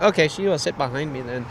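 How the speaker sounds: put-on soft, light Mexican accent; lightly imitated Mexican-accented English; imitated Mexican man. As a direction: retell it quietly in a mock Mexican accent. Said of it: "overweight Mexican guy".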